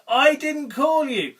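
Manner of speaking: put-on slow, high, loud grumble of an old priest; lightly voiced old priest sending a boy away; yawning old priest. A narrator's reading. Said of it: "yawned the old priest".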